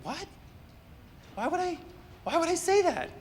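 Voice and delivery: put-on high, disbelieving kid's voice; a boy's shocked protest at odd advice; incredulous kid. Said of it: "And he goes".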